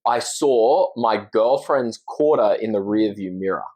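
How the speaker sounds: slow, careful Australian demo; Australian, each word laid out for copying; Australian accent. Shown as a demonstration. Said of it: "I'll say it in the Australian accent".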